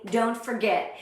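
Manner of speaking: usual speaking voice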